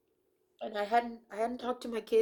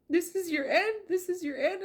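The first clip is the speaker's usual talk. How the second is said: devil's taunt; 9 semitones higher, 4 dB louder than usual. Retold or imitated.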